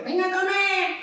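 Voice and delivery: a hint of a shrill-free high mum call; lightly voiced mother calling; mother's voice. Preacher mimics any mother's call to dinner, lightly voiced. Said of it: "la voz de tu madre".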